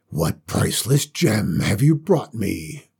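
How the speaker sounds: low dragon voice, a mild roar; lightly voiced roaring dragon. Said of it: "the dragon roared".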